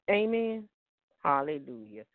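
ordinary talk, nothing marked

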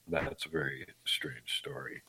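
hushed aside; whisper. Real, not acted. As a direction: whisper it to yourself. Aside after odd story.

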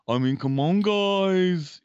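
hammed-up [name]-style British accent; bad [name] accent. Shown as a demonstration. Co-host shows friend a [name] impression, bad British accent. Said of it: "like, a bad [name] accent".

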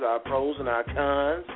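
ordinary delivery

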